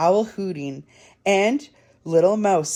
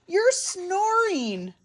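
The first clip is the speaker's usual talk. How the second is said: high, drawn-out complaint; little mouse complaining; 12 semitones higher, much slower than usual. A narrator's reading. Little Mouse telling Big Mouse he snores, high drawn-out complaint. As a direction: stretch it out high and slow. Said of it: "he called".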